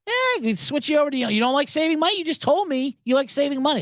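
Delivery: high, fast, mock-badgering rep; pushy rep. Comic quoting the rep pushing a switch, high and fast. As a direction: push the sale hard. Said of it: "That's what she said".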